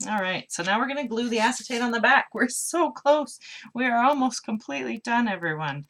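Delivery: high, loud burst of excitement; excited. Real, not acted. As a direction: cheer on the nearly finished project. Nearly finished with the card.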